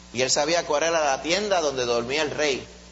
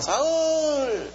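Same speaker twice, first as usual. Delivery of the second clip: high, ringing call across the camp; 11 semitones higher than usual. Retold or imitated. David calling out to Saul from afar. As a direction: shout the king's name to wake him.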